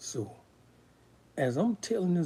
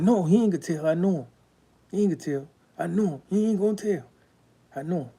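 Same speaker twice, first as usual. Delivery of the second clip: higher, lightly voiced father insisting; imitated insistent father; 5 semitones higher, 5 dB louder, faster than usual. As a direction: mimic a dad urgently vouching for someone. Father intervenes to stop a shooting.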